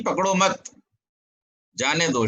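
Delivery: "पकड़ो मत" together, long pause, "जाने दो"; pause after मत. Shown as a demonstration. Teacher recalls the original instruction, pausing after मत. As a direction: hold a clear break before जाने दो. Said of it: "अब बात क्या कही गई थी?".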